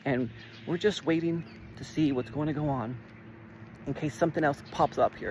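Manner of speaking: quiet, evasive gatekeeper voice; a lightly voiced stalling bureaucrat; imitating Jeff. Jeff stonewalling the caller about fraud, mild imitation. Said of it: "he's just like".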